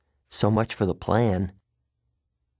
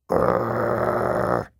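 low breathy growl, no voice; deep unvoiced monster growl; dog growl; 13 semitones lower than usual. Three-headed dog growls.

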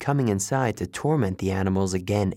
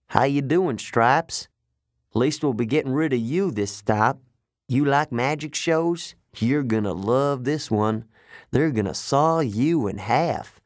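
taunting trucker voice, slightly raised; smirking trucker; 3 semitones higher than usual. Acted. Trucker to the caged zebra, smirking taunt. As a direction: needle the victim with mock friendliness. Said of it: "He smirked at the zebra".

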